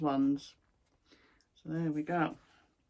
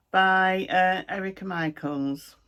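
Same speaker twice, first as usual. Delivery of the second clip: ordinary talk, nothing marked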